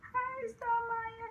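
very high, slow, drawn-out coo; tender baby greeting. Retold or imitated. Acting first greeting to her newborn.

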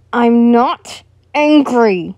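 slow, loud, forced denial; angry denial. Acted. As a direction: bite out each word slowly. Angry man denies anger.

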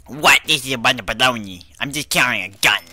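loud, hammed-up indignation; mock indignant protest. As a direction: protest loudly as if wrongly accused. Acts indignant protest at guards.

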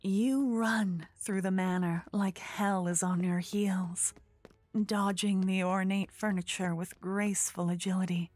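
ordinary talk, nothing marked

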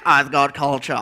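low, refined yogurt voice; posh yogurt voice. Acted. Yogurt greeting the fridge, low cultured voice. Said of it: "yogurt answers".

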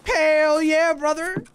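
big playful whoop, high and loud; hyped-up cheer. Pretend. Streamer hailing a viewer in army gear, hammed-up hype.

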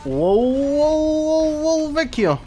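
pulled-out alarmed wow before fleeing; startled high wows. Real, not acted. Sudden danger in game.

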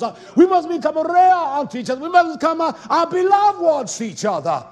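loud, high, fervent sermon climax; preaching at full force. Acted. Preacher crying out to his flock about brothers. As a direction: thunder it at the congregation.

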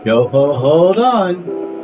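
loud, high, drawn-out yo ho; pirate-style halt. A narrator's reading. Farmer Fred stops Turkey at the door, loud pirate call. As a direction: build it slowly like a pirate's call.

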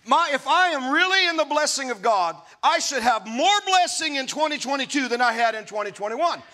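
ordinary talk, nothing marked

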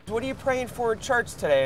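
ordinary talk, nothing marked

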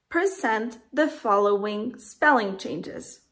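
ordinary talk

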